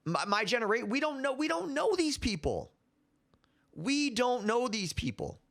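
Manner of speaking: high-pitched, insistent protest; exasperated protest. Real, not acted. Host defending his generation, exasperated. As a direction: push the pitch up, pleading the point. Protests being blamed for old events.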